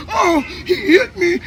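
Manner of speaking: slow, high mock whimper through laughter; mock whine. Hurt man calling on his walkie-talkie, mocked through laughter.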